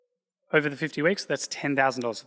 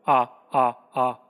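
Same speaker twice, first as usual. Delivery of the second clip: mock robot noise; 6 dB louder, slower than usual. Robot voice continues, teasing Eddie.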